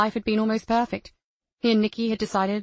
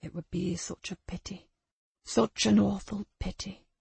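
menacing quiet voice; 5 dB quieter than usual. Acted. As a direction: menace her in a hushed voice. Abuser menacing child Lucy.

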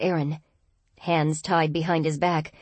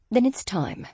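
put-on crisp English accent; 5 semitones higher than usual. A narrator's reading. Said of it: "he murmured in a crisp English accent".

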